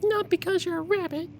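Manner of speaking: very high cartoon squeak; squeaky high voice. Silly high voice quote.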